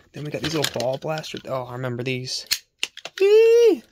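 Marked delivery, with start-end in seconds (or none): 3.2-3.8 high, loud squeal of delight; excited squeal. Real, not acted. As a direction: let out a sudden gleeful yelp. Delighted at finding old toys.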